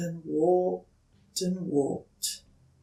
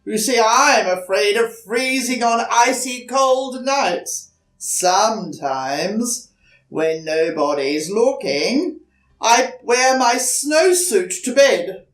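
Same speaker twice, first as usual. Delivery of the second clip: big polar bear; 6 semitones higher, 10 dB louder than usual. Acted. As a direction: rumble it out, then confess. The bear confides its fear.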